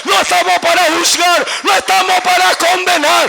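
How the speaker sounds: loud, high, shouted sermon; full-force preaching. Real, not acted. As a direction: shout it to the whole congregation in driving phrases.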